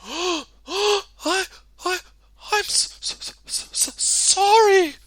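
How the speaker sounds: high, stuttering nervous apology; nervous stammer. A narrator's reading. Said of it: "stammered Mister Good nervously".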